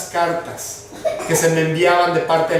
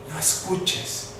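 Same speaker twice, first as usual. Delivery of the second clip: soft, voiceless whisper; hushed whisper, planting the idea; whispered insinuation; 10 dB quieter, slower than usual. Acted. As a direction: whisper it as the tempting enemy voice. Voices the enemy's insistent voice.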